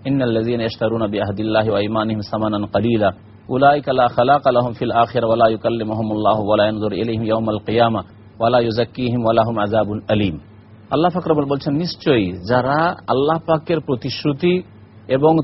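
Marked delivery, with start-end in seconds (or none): 0.1-10.4 low, even Arabic recitation; Quran recitation. Acted. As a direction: recite the verse evenly in a lowered pitch. (কোরআন তিলাওয়াত)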